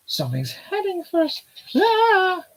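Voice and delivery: put-on high-pitched girly character voice; squeaky young girl voice.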